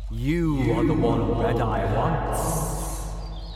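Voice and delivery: slow, loud, regal pronouncement; alicorn's voice in the narrator's mind; royal and powerful. A narrator's reading. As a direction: speak like a queen inside his head. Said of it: "Her voice was royal and powerful".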